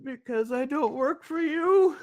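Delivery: high, giggling comeback; laughing mock retort; laughing quote. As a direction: toss the line back while laughing.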